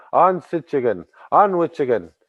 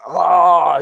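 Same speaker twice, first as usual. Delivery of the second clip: gagging sound; 3 semitones higher, 6 dB louder than usual. Acted. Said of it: "He's like".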